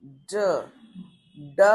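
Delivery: ड with the tongue curled back; Hindi retroflex D. Shown as a demonstration. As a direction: hit ड hard from the back of the mouth. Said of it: "सुन रहे हैं आप ध्यान से".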